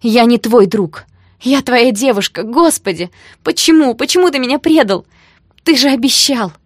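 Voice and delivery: loud, high accusation of betrayal; hurt, furious outburst; anguished shouting. A narrator's reading. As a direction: cry out, climbing to a peak. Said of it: "Я замолчала и зашептала".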